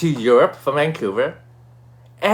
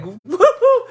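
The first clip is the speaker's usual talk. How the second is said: high, loud whoop; whooping celebration; 5 dB louder than usual. Retold or imitated. Winner reliving the announced number, jubilant high whoop. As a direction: cheer like you just jumped up. Retold jumping up at win.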